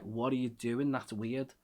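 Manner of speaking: normal talking voice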